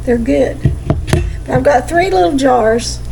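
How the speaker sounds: normal talking voice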